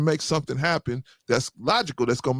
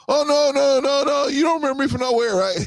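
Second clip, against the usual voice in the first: high flustered denial, lightly voiced; mock girl voice; 13 semitones higher, 3 dB louder than usual. Girlfriend denying she's met the friend, high mock voice. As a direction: wave it off quickly, higher and a bit louder.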